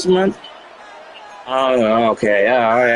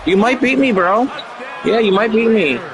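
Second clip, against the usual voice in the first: mock worry; 7 semitones higher than usual. Taunting opponent in mock worry.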